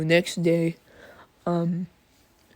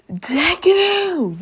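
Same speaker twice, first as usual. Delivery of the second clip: high, drawn-out battle yell; Bakugo; 12 semitones higher, 6 dB louder than usual. Retold or imitated. Rival charging at his opponent, imitated high yell. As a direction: scream the name as if charging in. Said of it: "start running down there yelling".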